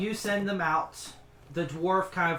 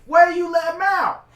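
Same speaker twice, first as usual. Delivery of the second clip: high, loud angry cook; 13 semitones higher, 12 dB louder than usual. Acted. The big woman with a rolling pin yells at the party, angry and high.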